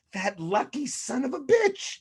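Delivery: high, slow, grudging curse; bitter grudging curse. Retold or imitated. Brady hater grudgingly cursing his luck, slow and high. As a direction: spit out envy at his luck. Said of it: "You're like".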